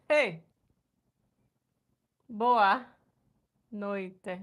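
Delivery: ordinary talk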